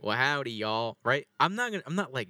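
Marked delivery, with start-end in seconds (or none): 0.0-1.0 put-on Southern accent. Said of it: "you have a, a southern accent".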